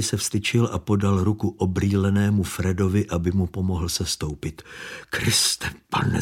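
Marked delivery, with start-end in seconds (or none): 5.1-6.2 breathless whispered stammer; the driver, stunned by the body, stammering; stammered in shock. A narrator's reading. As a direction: barely get the words out, stunned.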